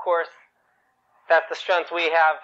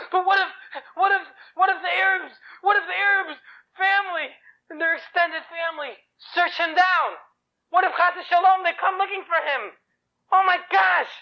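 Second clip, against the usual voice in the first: high-pitched, fretting mother; nervous; 11 semitones higher than usual. Acted. Mother fears the family will search for her son, nervous. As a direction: worry aloud in a raised, stammering voice. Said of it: "She's nervous".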